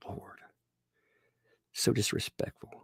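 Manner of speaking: unvoiced mock-offended whisper; whispered mock outrage. Real, not acted. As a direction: hush it out, scandalized. Aside at the screen.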